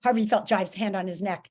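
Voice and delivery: plain reading voice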